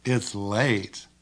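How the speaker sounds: low, drawn-out flirty hint; flirtatious. Shown as a demonstration. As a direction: stretch it out suggestively.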